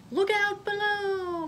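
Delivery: high, loud, slow call; screamed warning. Acted. Molly yelling as she races down the hill. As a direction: yell it while hurtling downhill. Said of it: "Molly Lou Mellon screamed".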